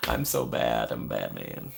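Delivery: very low gruff Batman growl; low growly superhero voice. Acted. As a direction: drop into a deep gravel Batman. Joke deep voice.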